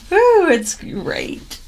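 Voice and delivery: slow, high sarcastic cheer. Real, not acted. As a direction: fake enthusiasm, drawn out. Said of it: "You can detect sarcasm there".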